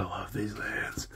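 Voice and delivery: hushed, secret confession; whispering. Real, not acted. Whispered after hates remark.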